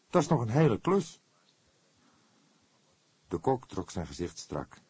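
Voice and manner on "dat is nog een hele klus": higher, louder fretting; anxious young detective; worried young man. A narrator's reading. Fledder frets to the cook, higher voice. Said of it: "vroeg hij zorgelijk".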